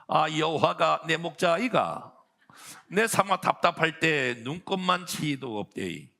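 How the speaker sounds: playful Gyeongsang country reading of the psalm; Gyeongsang dialect. Pretend. Preacher reading Psalm 23 to the congregation in Gyeongsang dialect, playful. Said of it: "경상도 버전으로 한번 여러분 제가 읽어드리겠습니다".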